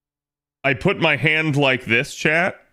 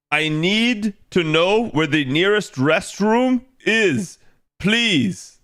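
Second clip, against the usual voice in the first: high, slow, overly careful American tourist in Japan; stiff American tourist; 6 semitones higher, slower than usual. Pretend. Streamer mocks how Japanese see an American tourist, high and slow. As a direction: play the clueless American abroad, high and slow. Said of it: "that's exactly the type of American".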